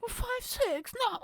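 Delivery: high whiny mock outrage; mock offended. Comedian offended by a height guess, high whiny voice. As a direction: act wounded.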